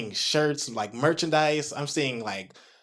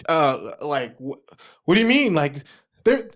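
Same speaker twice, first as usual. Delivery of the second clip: high, loud, stammering confusion; frozen panic; 10 semitones higher, 6 dB louder than usual. Retold or imitated. Flustered engineer forgetting the keyboard, high panicked stammer. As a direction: blank out completely mid-job. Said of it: "like, literally frozen".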